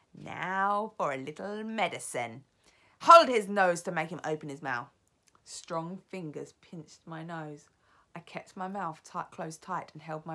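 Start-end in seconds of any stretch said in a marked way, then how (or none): 0.1-4.9 sly, then a loud order; gloating witch. Acted. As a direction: gloat over the bottle, then bark the order. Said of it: "and she said".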